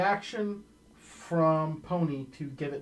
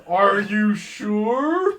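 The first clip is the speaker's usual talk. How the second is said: booming, slow, far louder; magically amplified voice; 3 semitones higher, 10 dB louder, much slower than usual. Acted. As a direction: boom it out like a giant voice. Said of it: "You hear the booming voice".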